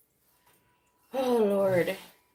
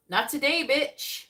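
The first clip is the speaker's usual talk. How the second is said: loud, higher, sassy dismissal; sassy brush-off; 6 semitones higher, 7 dB louder, slower than usual. Retold or imitated. Viewer sassily rejects an admirer, loud and high. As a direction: toss it off with attitude. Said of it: "you're like this".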